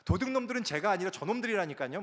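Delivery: missionary defending himself to God, rising in anger; indignant prayer. Retold or imitated. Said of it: "막 이러면서".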